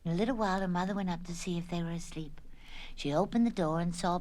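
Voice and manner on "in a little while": usual speaking voice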